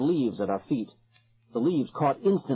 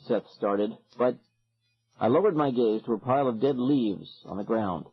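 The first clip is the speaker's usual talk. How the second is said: usual speaking voice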